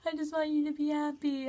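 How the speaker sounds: lightly voiced caring mother; imitating mom. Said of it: "she was like".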